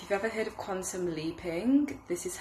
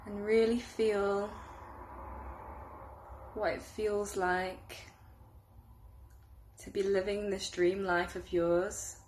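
unhurried meditation guide, growing hushed toward the end; guided meditation; 4 dB quieter than usual. Acted. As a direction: lead them gently through a visualization, softening as it deepens. Leads a guided visualization.

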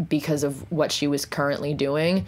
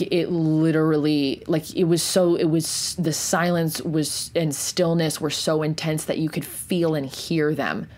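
usual speaking voice

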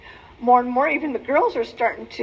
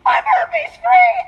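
much higher, louder, slow triumphant yell; elated cry of freedom; overjoyed shout; 8 dB louder, much slower than usual. Retold or imitated. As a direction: shout it out like you just got the best news. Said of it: "I'm like".

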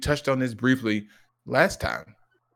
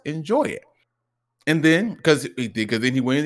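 normal talking voice

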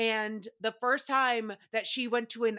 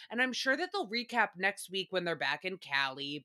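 ordinary talk

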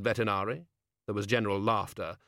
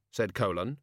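ordinary delivery

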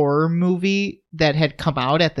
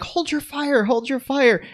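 high-pitched imitation of urgent calls; mock calling out; 11 semitones higher than usual. Retelling police stopping an officer shooting, mock high call. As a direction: call it out high, without extra volume.

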